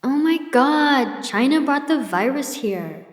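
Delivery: high, slow, whining complaint; whiny voice. Retold or imitated. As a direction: drag it out in a high whine. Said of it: "I heard a woman's whiny voice".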